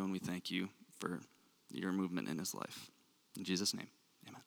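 earnest spoken prayer. Acted. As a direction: pray sincerely over him. Prays over the new intern.

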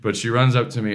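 normal talking voice

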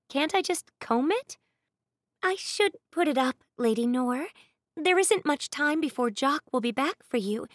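put-on busy servant girl voice; maid's voice. A narrator's reading. Maid Annabeth voiced in story.

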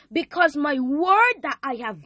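rising preacher cadence. Real, not acted. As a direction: preach it like a sermon. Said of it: "why you sound like a preacher".

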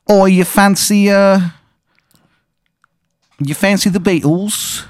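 high, hammed-up fake Cockney accent; really bad English accent. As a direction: squeak out a cartoon London accent. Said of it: "it's a really bad English accent".

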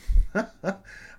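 loud, high burst of laughter; a real laugh bursting out. Real, not acted. Laughs at his own joke.

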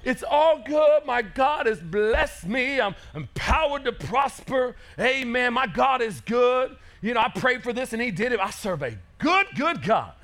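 mock happy believer. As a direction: ham up the blessed-and-happy believer. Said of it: "how many times are we like that with God".

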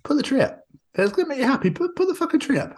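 high squeaky mock tenderness; a lightly squeaky, cooing take on his own words; mock sweet voice to girlfriend. Boyfriend urging upset girlfriend to put the tree up, high cooing voice. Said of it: "and I went".

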